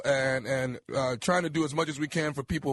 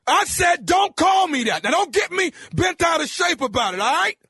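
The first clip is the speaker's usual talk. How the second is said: yelling, pitched way up; angry shout; 11 semitones higher, 5 dB louder than usual. Acted. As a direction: bark it at him, furious. Prank caller yelling at target.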